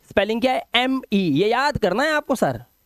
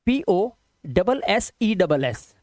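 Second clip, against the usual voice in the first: slow spelling; much slower than usual. Shown as a demonstration. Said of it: "possesses की spelling क्या होती है जी?".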